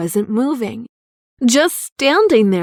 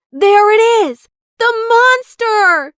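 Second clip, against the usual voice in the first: high, loud excited shout; 11 semitones higher, 8 dB louder than usual. A narrator's reading. Laura spots the monster under the blanket, shouting high. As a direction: yell it out, pointing at the monster. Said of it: "shouted Laura excitedly".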